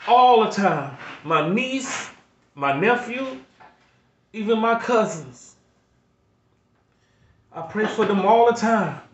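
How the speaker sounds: pleading, close to tears, pushing through; emotional. Real, not acted. Grandfather on his channel, overwhelmed thinking of his kids, emotional. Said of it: "I get a little emotional".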